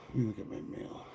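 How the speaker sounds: whispering. Real, not acted. Mutters to himself at screen.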